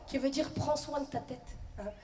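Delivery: usual speaking voice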